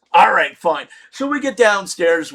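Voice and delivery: ordinary talk, nothing marked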